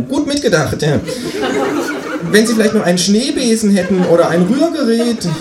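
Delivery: high-pitched kangaroo neighbour; kangaroo character. Acted. Kangaroo asks the new neighbour for eggs, high-voiced. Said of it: "sagt das Känguru".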